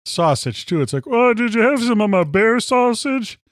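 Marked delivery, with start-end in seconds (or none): 1.1-3.4 high mock hunter voice; proud hunter voice. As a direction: play the proud bear hunter. Mocking a proud sausage maker.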